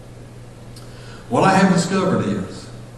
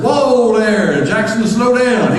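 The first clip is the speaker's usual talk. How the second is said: high, loud, stretched-out whoa; halting call; 8 semitones higher, 6 dB louder, much slower than usual. Retold or imitated. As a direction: rein in a runaway talker, slowing the last words. Halting the fast talker.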